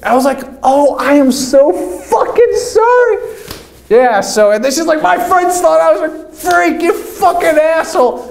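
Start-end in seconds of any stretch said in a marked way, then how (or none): 0.6-3.2 mock apology. His apology, retold. 5.0-8.3 put-on high girlish scolding voice; mock angry girl. Girl blaming him for her friends' teasing, high and indignant.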